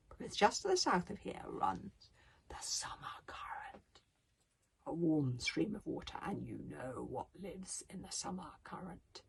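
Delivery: hushed spooky warning; ominous whisper. Acted. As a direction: whisper it like a ghost story.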